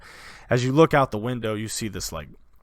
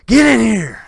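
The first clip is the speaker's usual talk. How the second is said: much higher, louder gloating brute; a bandit chief breaking into a house; bandit king; 9 semitones higher, 12 dB louder than usual. Acted. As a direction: bellow to his men.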